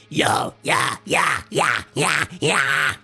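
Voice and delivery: high nasal rasp, teeth showing; nasal high scream. Shown as a demonstration. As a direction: get nasal up here and screech. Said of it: "So what that sounds like is like".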